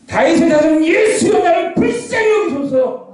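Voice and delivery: high, drawn-out shouted plea for mercy; all-out desperate shout. Acted. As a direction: cry out slowly at the top of the voice. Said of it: "피가 터지게 외치는 겁니다".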